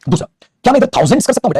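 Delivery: usual speaking voice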